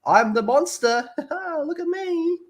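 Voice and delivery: high squeaky look-at-me voice, laughing through it; mock show-off actor. Mocking an actor who shows his face, high and squeaky. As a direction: squeal it like a vain actor begging for attention. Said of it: "to go".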